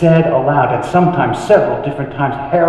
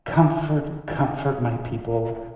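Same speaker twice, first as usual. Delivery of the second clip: tender comfort; 2 semitones lower, 9 dB quieter than usual. Acted. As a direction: let each phrase settle softly, with pauses. Repeats comfort to the people.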